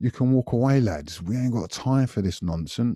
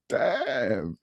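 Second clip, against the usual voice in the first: stunned damn; 5 semitones higher, 4 dB quieter than usual. Retold or imitated. Relief after robbers leave.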